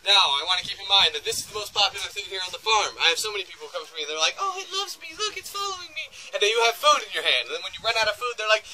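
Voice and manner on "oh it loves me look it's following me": high, softer mock-adoring squeal; gushing visitor. Guide mimics visitors flattered by the reindeer, high gushing voice.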